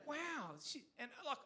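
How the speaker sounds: normal talking voice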